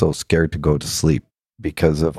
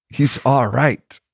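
slow, high-pitched Irish imitation; bad Irish accent; 8 semitones higher, slower than usual. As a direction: draw it out as an old Irishman. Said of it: "not doing a very good Irish accent".

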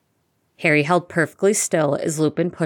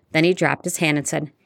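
ordinary delivery, nothing marked

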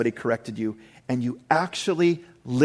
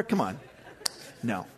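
ordinary delivery

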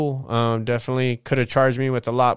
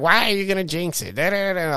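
higher, lightly mimicked whine; mock girlfriend voice; 6 semitones higher than usual. Mimicking his lady scolding him in the car, mild mock high voice. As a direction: lightly imitate her complaint. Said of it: "And she was like".

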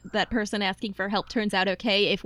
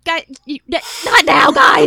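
loud, high-pitched yell; exasperated shout; 6 semitones higher, 10 dB louder than usual. Acted. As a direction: shout the group down. Player calls out over interruptions.

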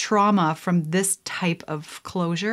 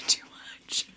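whispered aside with a laugh; whispering; 9 dB quieter than usual. Real, not acted. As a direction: whisper it to yourself. Repeated line, first a whisper.

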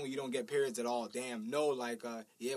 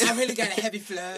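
mock woman; 6 semitones higher, 9 dB louder than usual. Mocking a woman's voice.

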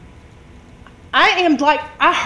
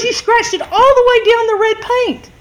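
retold accusation; 7 semitones higher, 4 dB louder than usual. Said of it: "She goes".

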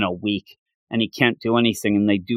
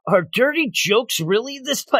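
confused quote; 9 semitones higher than usual. Retold or imitated. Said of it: "And then you have Gigi".

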